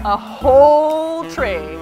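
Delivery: "whole" drawn out long and loud; slow, swelling stress on "whole"; amazed emphasis. Real, not acted. Amazed at a full tray.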